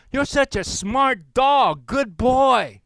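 high, slow, loud doggy praise; praising a dog. Retold or imitated. Teacher praising the newspaper dog, high slow coo. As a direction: coo it out like rewarding a good boy.

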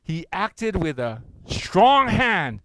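loud, slow, measured reading aloud; congregational scripture reading. Acted. Pastor leading the church in reading Psalm, loud and slow. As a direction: lead the room through the verse.

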